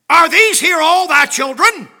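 loud, high preacher's question; preaching at full force. Acted. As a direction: cry it out to the church. Preached scripture.

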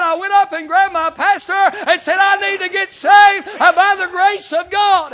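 loud high cry of need; a sinner calling out to be saved, shouting; retold shout. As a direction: shout it like grabbing the pastor.

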